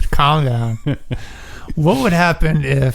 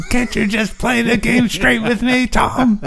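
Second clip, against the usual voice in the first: much higher laughing voice; high mock voice; 8 semitones higher than usual. Pleading with Tom, high laughing voice.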